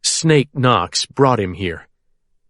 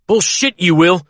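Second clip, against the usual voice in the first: angry retort; 6 semitones higher than usual. A narrator's reading. Refusing Carl angrily.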